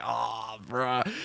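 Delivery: low, soft retold groan of sympathy; deflated disappointment. Man let down by missing nuggets, low soft disappointment. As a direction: let it sink low and quiet.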